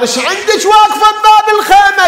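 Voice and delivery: high, loud calls rising to a shout; urgent shout. Acted. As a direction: warn her frantically about the fire. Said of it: "قلت لها". (صيحة تحذير عالية: النار النار)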